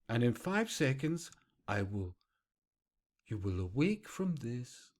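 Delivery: soft, low hypnotist patter with pauses. Acted. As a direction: lull them quietly, like a stage hypnotist. Mimics a hypnotist's wake-up command.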